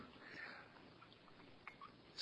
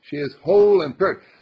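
louder, higher, insistent declaration; with conviction; 3 semitones higher, 7 dB louder than usual. Shown as a demonstration. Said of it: "Say it and know it".

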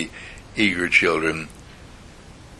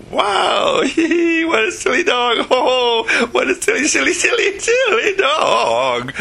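mocking laughter; slower than usual. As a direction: cackle at the silly dog. Family laughs at the dog.

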